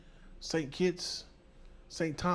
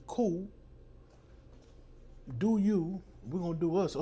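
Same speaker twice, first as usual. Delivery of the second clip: defiant brush-off; 6 semitones higher than usual. Retold or imitated. Quoting own defiant reply.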